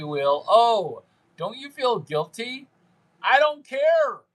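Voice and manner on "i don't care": defiant shout. Acted. Mock defiance at chat.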